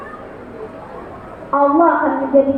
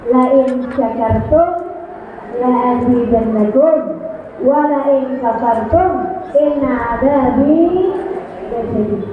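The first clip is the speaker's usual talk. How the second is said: Quranic recitation; 5 semitones lower than usual. Acted. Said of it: "Allah bilang".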